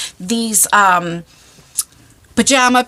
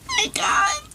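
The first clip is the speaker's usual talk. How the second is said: very high, soft squeal of laughter; high laugh-cry; 7 dB quieter than usual. Real, not acted. Remembering pulling the pants up, laugh-crying.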